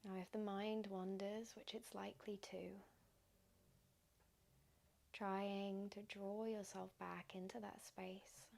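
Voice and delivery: soft, unhurried meditation voice with long pauses; guided meditation. Acted. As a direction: gently guide listeners into stillness, leaving space between phrases.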